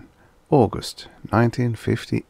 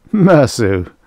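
incredulous priest; 4 semitones higher, 8 dB louder than usual. A narrator's reading. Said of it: "then burst into laughter".

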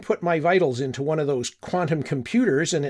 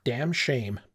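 ordinary delivery, nothing marked

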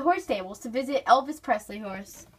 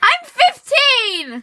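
indignant shout; 11 semitones higher, 8 dB louder, much slower than usual. Real, not acted. Indignant about being called four.

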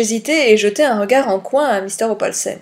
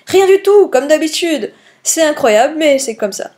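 teasing rebuff; 5 semitones higher, 4 dB louder than usual. A narrator's reading. Poirot mocks his friend.

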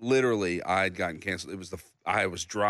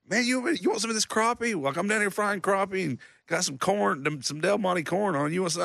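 much higher, goofy friendly offer; voicing his brother; 11 semitones higher than usual. Imitating his brother offering fried crappie, high goofy voice. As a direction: play the cheerful brother frying fish.

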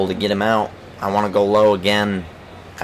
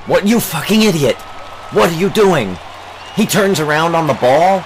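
enraged yelling, much higher and louder; furious outburst; 8 semitones higher, 3 dB louder than usual. Real, not acted. Player raging at his teammate's dumb play, furious yelling. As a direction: blow up at a teammate's blunder. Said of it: "angry outbursts that might come from me".

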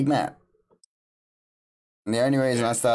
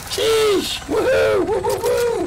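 very high, loud whoops; excited cheering; 6 dB louder, slower than usual. Real, not acted. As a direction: whoop like you just won. Cheering the raid on stream.

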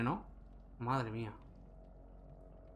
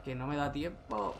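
ordinary talk

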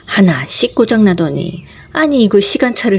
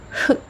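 tiny mock sob; 9 dB quieter, slower than usual. Mock sob after listing hardships.

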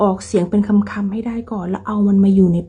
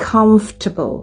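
"comfortable" as CUMF-tuh-bul; "comfortable" falling after the first syllable; first-syllable stress; much slower than usual. Shown as a demonstration. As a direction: stress the start of "comfortable", reduce the rest. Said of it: "พยางค์แรก".

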